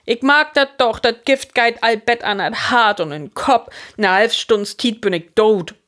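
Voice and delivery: high, agitated lament; despairing lament. A narrator's reading. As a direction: wail that the end is near. Hein despairs, sure he is dying. (hooch un vertwiefelt jammern)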